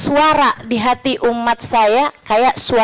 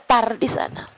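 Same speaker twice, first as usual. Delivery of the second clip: quiet aside; 5 dB quieter than usual. Real, not acted. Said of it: "repeated louder".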